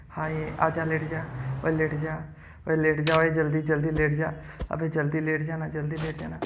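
hushed coaxing to the pet; soft coaxing. Real, not acted. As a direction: coax it quietly to lie down.

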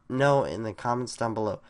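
ordinary talk, nothing marked

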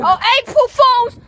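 loud mock outrage; shout. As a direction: yell it out in fake outrage.

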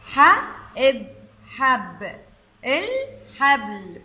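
حبل built slowly from حب and إل; sounding out. Shown as a demonstration. Teacher introducing the word حبل, syllable by syllable. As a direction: separate the parts, then blend into حبل. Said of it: "عنا كلمة".

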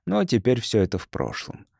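usual speaking voice

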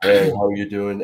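low and full, how you think you sound; own voice as you hear it. Shown as a demonstration. Podcaster showing how his voice sounds in his head, low. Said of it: "I thought I sounded like this".

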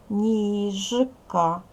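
Ніжка split as ніж, ка, loud with a voiced ж; two syllables. Shown as a demonstration. Teacher counting syllables for pupils, Ніжка in two separate parts. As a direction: break Ніжка after a long, buzzing ж. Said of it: "Два склади".

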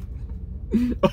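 short breathy laugh; delighted laugh. Real, not acted. Reacting to first bite.

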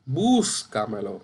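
Búscamelo slowed, first syllable BÚS drawn out; stress on BÚS. Shown as a demonstration. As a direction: lean hard on BÚS, let camelo fall away. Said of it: "Esa es sobre esdrújula".

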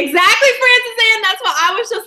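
high, loud eager agreement; excited. Real, not acted. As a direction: burst out in delight.

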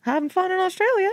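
high-pitched little bird voice; talking bird. Acted. Guest voices Australian birds for co-host, high bird voice. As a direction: pipe it up like a chatty parrot. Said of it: "What are they saying?".

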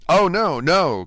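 high, loud, urgent protest; crying out. A narrator's reading. Dante denying he could be a traitor, crying out. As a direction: cry out in urgent denial. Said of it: "cried Dante".

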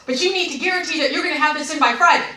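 loud, high, hurried insistence; insistent imitation. Rep demanding guarantee from customer, insistent. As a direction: steamroll over the caller as the rep.

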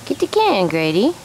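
high sing-song pet voice; teaching a parrot. Real, not acted. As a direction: coax the bird, high and sweet. Coaxing a parrot to talk.

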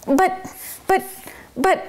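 high, stuck on but, but, but; a mouse lost for words, sputtering; spluttering helplessly. Acted. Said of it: "Tucker Mouse was spluttering helplessly".

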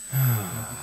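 long relaxing exhale; deep sigh. Real, not acted. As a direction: let out a slow deep breath. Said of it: "I just wanna take a moment and just breathe".

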